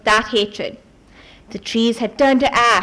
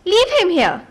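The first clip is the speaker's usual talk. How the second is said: shout; 7 semitones higher than usual. A narrator's reading. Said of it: "Dream shouted".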